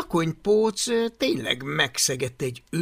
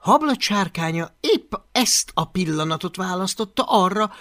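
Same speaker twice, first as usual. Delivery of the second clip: ordinary delivery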